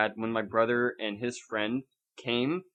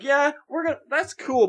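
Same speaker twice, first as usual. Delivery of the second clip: much higher, louder playful mock voice; mock filmmaker voice; 13 semitones higher, 6 dB louder than usual. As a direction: voice the filmmakers topping the idea with glee. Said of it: "it's like".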